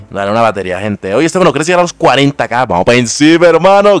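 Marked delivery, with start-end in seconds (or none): 2.6-4.0 loud, rising, high rallying shout; excited cheer. Real, not acted. Streamer answering a viewer about forty K, excited cheer. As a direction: cheer it out with hype. Cheering on toward a follower goal.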